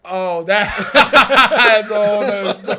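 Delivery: loud, high words through laughter; laughing hard. Real, not acted. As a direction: crack up while trying to talk. Cracks up at remembered car story.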